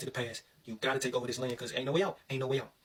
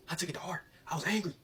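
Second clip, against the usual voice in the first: Sister James; 4 semitones higher than usual. Real, not acted. Telling how the drills got to him, worked up. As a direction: voice jumping up with indignation. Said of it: "I just sounded like Sister James".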